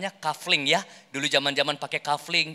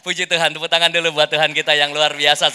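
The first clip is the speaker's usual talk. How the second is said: ordinary delivery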